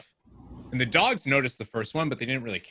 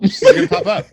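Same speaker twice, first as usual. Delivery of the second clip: playful spooky squeal; 12 dB louder than usual. Pretend. Echoes friend, playful spooky warning.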